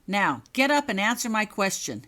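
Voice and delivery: loud stern order; commanding. Acted. Prefect ordering Holly off the floor, loud command. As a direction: bark the order down at her. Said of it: "he commanded".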